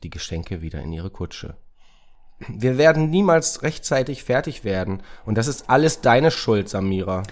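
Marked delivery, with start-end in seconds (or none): 2.5-7.2 loud, high voice of a stressed sidekick; fretful Racket, scolding. Acted. Racket blames Samira for running late, loud reproach. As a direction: blame your partner loudly, pitched up.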